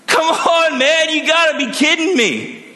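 high, loud whiny disbelief; exasperated Jonah. Retold or imitated. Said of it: "Jonah's like".

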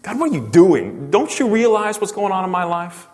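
high, accusing plea to God; indignant protest. Acted. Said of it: "had that rise up within you".

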